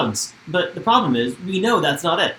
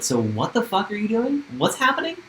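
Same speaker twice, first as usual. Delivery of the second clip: imitating Hannah Ann, pitched much higher in disbelief; mock shocked, voice climbing higher; incredulous quote; 9 semitones higher than usual. Retelling Hannah Ann's shock at Peter's visit, much higher and incredulous. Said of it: "she's like".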